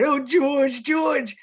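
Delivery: high, loud call for help; crying out. A narrator's reading. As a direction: cry out his friend's name in panic. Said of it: "And he cried".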